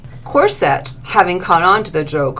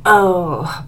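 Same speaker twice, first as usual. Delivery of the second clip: breathed; 4 dB louder than usual. A narrator's reading. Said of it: "breathed Lily".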